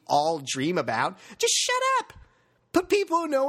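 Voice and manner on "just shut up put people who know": much higher, fed-up cry of shut up; exasperated outburst. Real, not acted. Fan pleading the owner to stop talking, fed-up high cry. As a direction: throw up your hands and yelp it.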